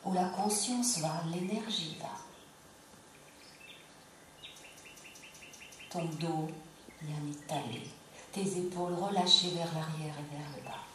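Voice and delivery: calm yoga nidra guidance with long pauses; unhurried meditation voice, pausing between phrases; guided relaxation. Acted. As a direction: guide them gently into rest, phrase by phrase.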